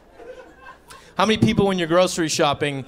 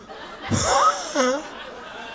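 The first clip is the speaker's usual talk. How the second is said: high wondering gasp. Acted. Caveman discovers carrot.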